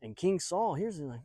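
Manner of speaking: ordinary delivery, nothing marked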